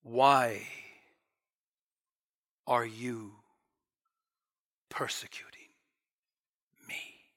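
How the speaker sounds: slow, low, hushed, with long pauses; gentle divine rebuke. Acted. Jesus speaking to Saul from heaven, gentle and hushed. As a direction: sink gently into a whisper, word by word. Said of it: "isn't this the most gentle".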